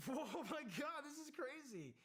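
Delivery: high, loud stunned exclamation; alarmed disbelief. Retold or imitated. Recruit watching the chaos, loudly stunned. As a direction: blurt it out, shocked. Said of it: "And you're like".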